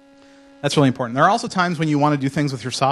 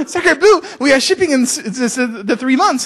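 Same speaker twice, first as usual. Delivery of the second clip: loud, high-pitched French indignation, hammed up; mock-French outburst; French accent; 13 semitones higher, 9 dB louder than usual. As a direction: protest like an outraged comic Frenchman. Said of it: "do this in a French accent".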